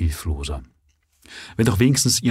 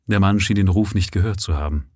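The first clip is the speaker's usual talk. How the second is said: usual speaking voice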